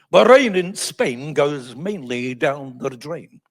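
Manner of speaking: "rain", "Spain", "mainly", "drain" drawn out slowly; mock elocution drill on "rain in Spain"; elocution exercise. Shown as a demonstration. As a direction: recite "The rain in Spain" with slow, exaggerated vowels. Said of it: "elocutions that will help me".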